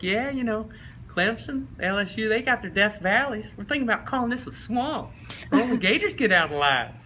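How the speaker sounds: high-pitched coach voice; coach impression. Coach telling recruits about the swamp, high-pitched imitation. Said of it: "and he's like".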